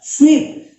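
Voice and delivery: SVEEP said high and loud as the correction; loud corrected word. Shown as a demonstration. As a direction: stress SVEEP clearly, fixing the slip. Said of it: "sorry मैं गलत पढ़ रहा था". (सुधार कर SVEEP ज़ोर से)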